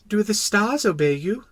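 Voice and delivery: put-on high-pitched child's question; wondering little prince. Little prince asking about the stars, wondering. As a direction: ask in small-voiced wonder.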